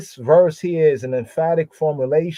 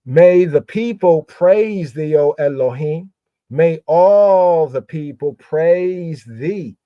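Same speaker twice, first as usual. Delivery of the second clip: fervent psalm reading; 2 semitones higher, 5 dB louder than usual. Acted.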